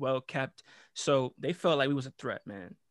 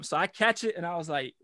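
ordinary delivery

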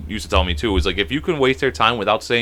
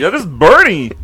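silly disguised voice, pitched up and pushed; put-on phone voice; 6 semitones higher, 7 dB louder than usual. Prankster naming himself to a caller, put-on voice. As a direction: echo it high and loud.

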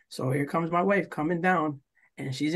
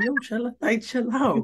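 higher-pitched plea to back off; mock pleading, pitched up; retold exasperated plea; 7 semitones higher, slower than usual. Telling captain to chill out, exasperated plea. Retelling his plea to calm down.

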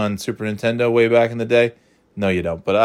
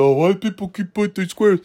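mock complaining; 10 semitones higher than usual. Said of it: "people get upset".

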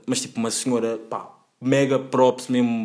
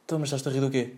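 softer imitated driver, in an altered voice; corrected driver impression; 6 dB quieter, faster than usual. Driver confronting a laughing passenger, quietly imitated challenge. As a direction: quietly challenge him as the driver, lightly put on. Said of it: "Não, não diz isto, diz tipo".

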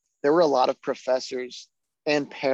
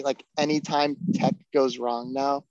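ordinary talk, nothing marked

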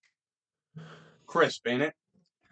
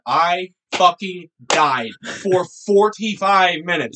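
usual speaking voice

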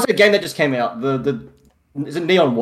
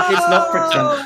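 laughing, much higher; laughing protest; 13 semitones higher, 5 dB louder than usual. Real, not acted.

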